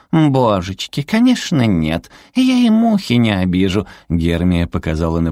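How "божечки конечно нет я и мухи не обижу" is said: high, cutesy protest; innocent little creature. A narrator's reading. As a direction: swear innocence in a sweet high voice. Зверёк притворно невинно оправдывается. (невинный высокий голосок зверька)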